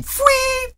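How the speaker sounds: very high whistling sound effect; a squealing flight whistle; whistling fwee. Retold or imitated. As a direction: imitate a pony flying through the air. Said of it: "It was so awesome".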